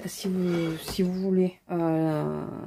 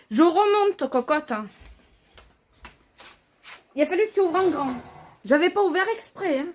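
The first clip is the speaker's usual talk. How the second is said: talking to a dog; 8 semitones higher, 4 dB louder than usual. Real, not acted.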